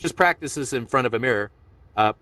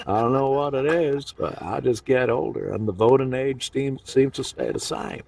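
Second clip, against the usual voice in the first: lightly voiced Trudeau musing; a hint of Trudeau at the podium; Justin Trudeau. Comedian's Trudeau joke to the room, lightly voiced. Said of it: "my Justin Trudeau impression".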